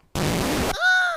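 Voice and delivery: a sharp, high, hammed-up gasp; theatrical high gasp; mock gasp. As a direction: gasp in fake horror.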